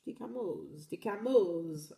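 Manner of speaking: mock Portuguese accent, laughing at the end; playful Portuguese accent on Camões; Portuguese accent attempt. Shown as a demonstration. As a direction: put on a Portuguese accent for the name, half laughing. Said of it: "try and do it in, like, a, the accent".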